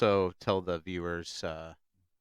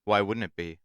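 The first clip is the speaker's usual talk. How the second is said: ordinary talk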